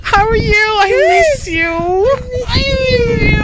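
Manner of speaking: high, teary voice with a slow, breaking "I miss you"; tearful reunion. Real, not acted. Emotional reunion with friend Marion.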